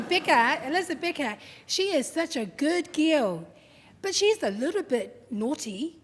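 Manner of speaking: loud, lightly acted speechmaking; wedding speech impression. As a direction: proclaim it like a proud father's toast. Quoting dad's wedding speech.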